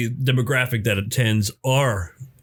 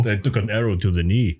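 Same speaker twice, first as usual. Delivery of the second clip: Skyrim guard impression; 4 semitones lower than usual. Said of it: "impressions of characters from Skyrim".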